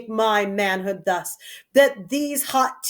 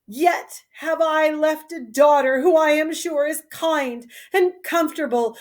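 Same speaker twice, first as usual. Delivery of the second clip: ordinary delivery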